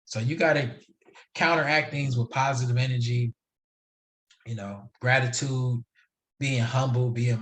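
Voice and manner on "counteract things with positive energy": ordinary talk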